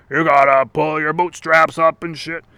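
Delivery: mock a blustering lecturer; mocking impression. Supposed adult lecturing on bootstraps, mocking.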